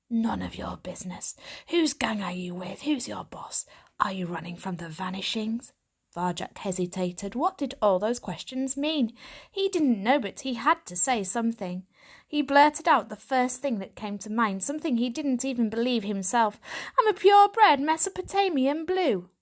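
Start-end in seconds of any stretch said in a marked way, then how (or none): 0.1-5.7 gravelly tomcat-like she-cat. Acted. 17.0-19.3 higher, blurted boast; proud young cat. Acted. Varjak blurts proud claim.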